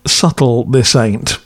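usual speaking voice

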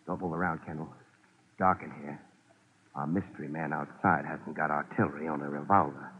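low hushed voice in the dark. Acted. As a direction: keep it down, someone's outside. Hiding from gunman in dark room.

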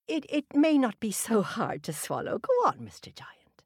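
high, soothing wife's voice; Una, sweetly coaxing. A narrator's reading. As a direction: tempt him to another loaf.

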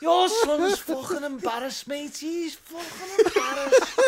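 jeering Liverpool bloke hurling insults; very angry Scouser. Retold or imitated. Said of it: "impersonating a very angry Scouser".

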